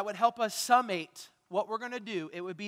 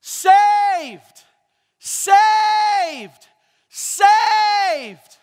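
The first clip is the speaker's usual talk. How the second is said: high, booming, long-held cry; triumphant shout; 11 semitones higher, 16 dB louder, much slower than usual. Acted. Preacher declares what Hosanna now means, triumphant shout. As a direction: proclaim it at full volume, stretching the vowel.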